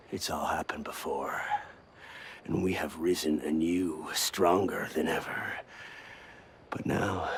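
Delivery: soft, half-whispered speech; grave, hushed. Acted. Veteran assassin laments Brotherhood's lost purpose, grave and hushed. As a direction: drop to a hushed, solemn explanation. Dying away to grave confession.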